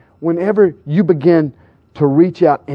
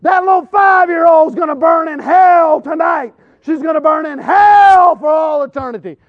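very high, loud shouted damnation; condemning shout; 13 semitones higher, 4 dB louder than usual. Retold or imitated. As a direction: scream judgment at the mother and child. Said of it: "he goes".

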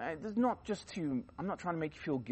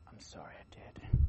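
meek confession; 7 dB quieter than usual. Retold or imitated. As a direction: imitate a caught cheat mumbling sorry. Mocking runner's whispered confession.

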